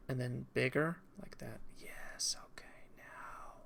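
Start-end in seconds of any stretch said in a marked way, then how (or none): 1.2-3.5 whispered to himself. Real, not acted. Streamer finishing a stroke, whispering to himself. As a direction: murmur it unvoiced, focused on the drawing. Quietly satisfied while drawing.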